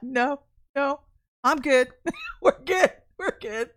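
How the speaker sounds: nervous giggles breaking through reassurances; holding back laughter. Retold or imitated. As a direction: reenact a kid failing not to laugh. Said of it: "trying to keep yourself from laugh".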